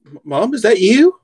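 high, unsure stammer; stammering Oliver. Acted. Oliver calls to his mom, high and stammering. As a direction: call out to a mother you can't believe.

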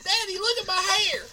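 put-on very high, squeaky little kid; child voice. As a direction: whine it like a small child. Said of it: "That's what your children would say".

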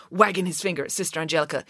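plain reading voice

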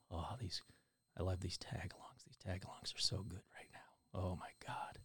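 hushed, low, indulgent whisper; swooning over snacks in a joke whisper; mock ASMR whisper. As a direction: whisper blissfully as a parody of ASMR. Mock ASMR whispering while eating cookies.

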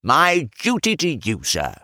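put-on high, drawn-out old man's greeting; elderly man. A narrator's reading. Old servant paying respects to the chemist, high aged voice. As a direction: pipe it up slowly like an old man.

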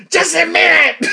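high, loud annoyed yell; screaming mother. Retold or imitated. Mother hollering at somebody knocking at her door, imitated scream. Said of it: "it's my mother screaming".

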